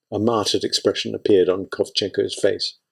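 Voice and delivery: plain reading voice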